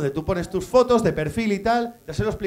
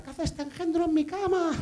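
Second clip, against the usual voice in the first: mock woman's scream; 10 semitones higher, 6 dB quieter than usual. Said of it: "la chica se puso a gritar".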